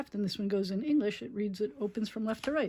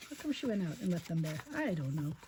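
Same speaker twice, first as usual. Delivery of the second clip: plain reading voice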